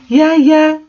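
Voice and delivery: a hint of put-on gloom; mock sad. A narrator's reading. Ebony answering Draco in a high squeaky voice. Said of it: "I said sadly".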